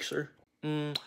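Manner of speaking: usual speaking voice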